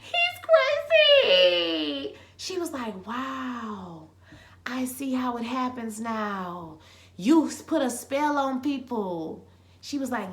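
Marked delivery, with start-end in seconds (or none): 0.0-2.2 very slow, high sing-out; hammed-up, drawn-out high-pitched exclamation; high squeal. Same words whispered, then squealed. 3.1-9.5 slow, drawn-out marvelling; mock-dramatic hushed start, slowly building; imitated awed realisation. Imitating her slow dawning realisation.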